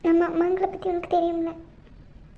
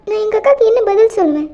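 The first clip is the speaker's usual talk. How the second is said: normal talking voice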